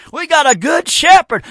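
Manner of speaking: high, loud proclamation; fervent preacher shout; preaching shout. Real, not acted. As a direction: cry it out to the congregation.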